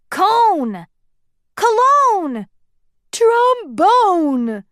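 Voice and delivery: high, loud shouted guesses. A narrator's reading. Dwarfs shouting ideas at once, loud. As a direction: yell each guess over the others. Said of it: "dwarfs shouted ideas".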